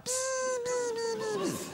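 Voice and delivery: high, thin buzzing noise like a radar. Pretend. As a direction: buzz like a tiny antenna. Imitates relationship GPS buzzing. (hohes, dünnes Summen wie ein Radar)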